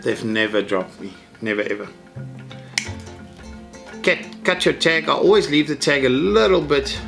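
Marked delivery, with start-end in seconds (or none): none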